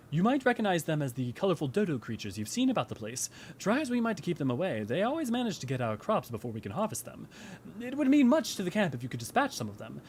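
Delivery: lilting, polite character voice; courteous camp retainer. Acted. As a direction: ask for help like a well-mannered game character.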